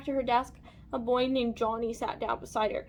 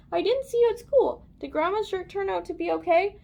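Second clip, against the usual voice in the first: a hint of Mom, pitched up; lightly voiced mother; 6 semitones higher, 4 dB louder than usual. A narrator's reading. Mother questions Lacey after school, lightly voiced. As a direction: ask it in a higher motherly voice.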